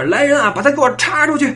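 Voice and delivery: high angry order to the guards; commanding shout. Retold or imitated. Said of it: "大喊一声".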